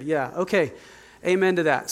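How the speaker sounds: ordinary talk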